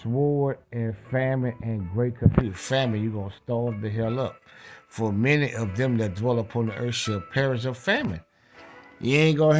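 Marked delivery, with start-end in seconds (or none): none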